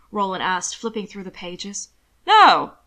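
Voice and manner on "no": loud, high-pitched protest; indignant denial. Acted. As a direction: burst out with an offended denial. Zeller denies stealing the papers.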